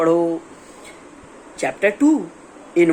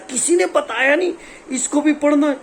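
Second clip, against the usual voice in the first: higher-pitched mock student complaint; protesting student; 9 semitones higher than usual. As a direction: plead like an aggrieved student. (शिकायत करता छात्र, ऊँची आवाज़ में)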